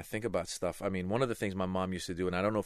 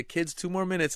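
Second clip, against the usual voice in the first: gentle dad call; 10 semitones higher, 4 dB louder than usual. Retold or imitated. Father calling kids gently.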